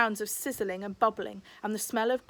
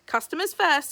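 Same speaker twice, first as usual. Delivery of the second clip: brisk Rambushy; 6 semitones higher, 6 dB louder than usual. Acted. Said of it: "said Rambushy".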